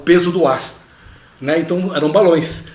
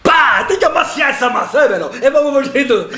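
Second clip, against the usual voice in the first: put-on Italian accent; 8 semitones higher than usual. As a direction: yell at the kitchen as an Italian chef. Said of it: "E com muito sotaque italiano".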